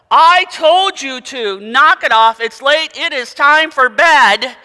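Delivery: put-on high, loud, scolding old granny voice; stern grandmother. Grandmother scolding noisy grandsons at bedtime, high and loud. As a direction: bark at the boys to get to bed.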